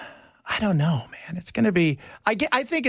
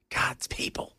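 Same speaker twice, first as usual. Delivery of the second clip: breathy whisper under the breath; whispered aside; 8 dB quieter, slower than usual. Acted. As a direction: mutter it as a hushed aside. Mock despair aside.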